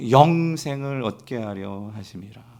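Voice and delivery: slow, low scripture close; leading unison reading. Real, not acted. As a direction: bring the shared verse down to rest. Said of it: "같이 읽어볼게요". (낮고 느린 성경 봉독)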